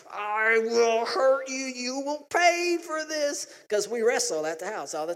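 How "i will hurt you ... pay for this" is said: slow, high, strained threat; mock aggression. Daughter threatening while wrestling, slow hammed-up aggression. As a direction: draw out each word menacingly. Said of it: "that type of aggression".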